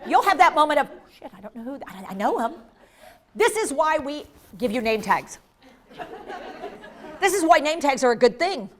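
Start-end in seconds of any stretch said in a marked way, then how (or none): 1.1-2.5 very soft aside to oneself; hushed, acted fluster; muttered panic. Imagined moment of forgetting a name.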